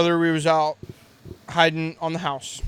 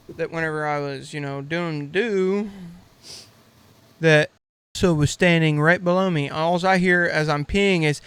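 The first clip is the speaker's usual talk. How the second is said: usual speaking voice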